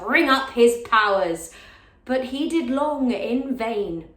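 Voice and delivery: loud, slow, rising plea in a stage delivery; impassioned Lady Percy. Acted. Lady Percy begging her father-in-law not to go to war, impassioned plea. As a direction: plead from the heart, weighting the words.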